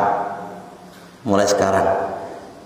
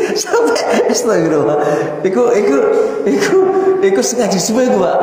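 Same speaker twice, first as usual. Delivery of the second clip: high laugh; 10 semitones higher than usual. Real, not acted. Ustaz menertawakan cerita.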